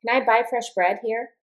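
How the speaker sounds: "Can I buy fresh bread here?" lifting at the end; yes-or-no question, voice goes up towards HERE; rising question intonation. Shown as a demonstration. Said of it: "the intonation will go up".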